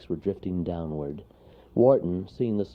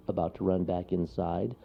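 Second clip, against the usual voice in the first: ordinary delivery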